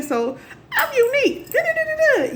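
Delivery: very high, squeaky sing-song; a silly high-pitched little chant; playful younger self. Retold or imitated. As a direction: mimic a kid showing off. Acts out her young self, delighted.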